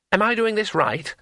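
high, uncertain question; anxious. Acted. Tourist holding a sword on the thief, anxious. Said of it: "asked Two Flower anxiously".

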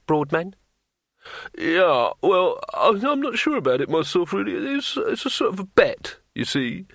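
nervous innkeeper. A narrator's reading. Fat innkeeper cornered by the thief master, stammering nervously. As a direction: squeak out excuses under pressure. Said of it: "said the innkeeper nervously".

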